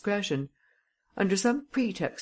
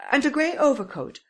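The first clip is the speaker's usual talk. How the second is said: higher, louder, stubborn; insistent repeat; 10 semitones higher, 4 dB louder than usual. Acted. Madame Renaud insisting to the commissary, higher, louder. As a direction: she won't be contradicted. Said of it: "repeated Madame Renaud".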